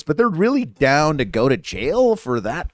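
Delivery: normal talking voice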